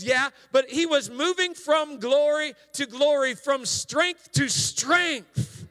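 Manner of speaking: high, loud, fervent sermon climax; full-force preaching. Real, not acted. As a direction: preach it out with rising fire.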